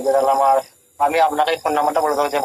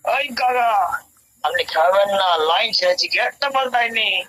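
higher, slow, swaggering film-hero delivery; with attitude; 5 semitones higher, slower than usual. Acted. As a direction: act tough like a movie hero on the retake. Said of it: "একটু মেজাজ নিয়ে". (মেজাজ নিয়ে হিরোর মতো dialogue)

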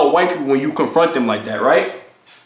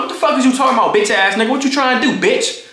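angry confrontation; 6 semitones higher than usual. Retold or imitated. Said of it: "I'm talking to him like that".

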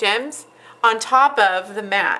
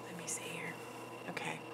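whispered to herself; 3 semitones lower, 20 dB quieter, faster than usual. Real, not acted. Muttering to herself while working.

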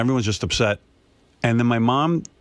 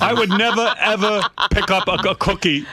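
father scolding mother over the fortune, higher mock voice; imitating her father; 9 semitones higher than usual.

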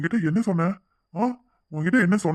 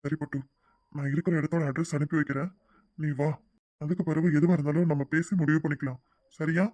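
hushed, tender reassurance; reassuring; 2 semitones lower, 4 dB quieter than usual. Acted. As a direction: quietly take charge and comfort. Comforting a distressed lover.

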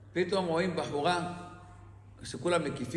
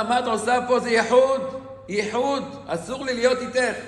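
retold shout; 4 semitones higher, 5 dB louder than usual. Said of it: "הוא צועק לייחוד".

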